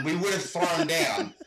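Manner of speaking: ordinary talk, nothing marked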